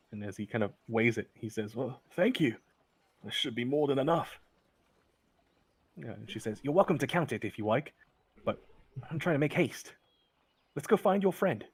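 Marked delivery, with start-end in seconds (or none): 1.8-4.4 lightly voiced gruff priest, a bit higher; pleased, weighing the coin; a hint of a grateful traveler; Sheldon's voice. GM voices Sheldon accepting pouch. 6.6-11.6 higher, pressing voice, rising toward the end; urging him to hurry; a woman in a rush, pitch climbing; hurried woman. Acted.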